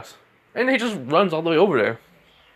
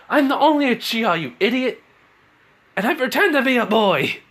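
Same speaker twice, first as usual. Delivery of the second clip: high-pitched angry retort; indignant outburst; 10 semitones higher than usual. Acted. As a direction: snap back hotly, pitch way up. Siren snaps back at Orochimaru.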